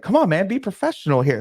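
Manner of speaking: ordinary talk, nothing marked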